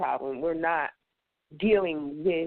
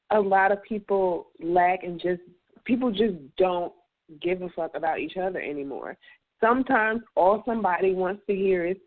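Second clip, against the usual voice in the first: ordinary talk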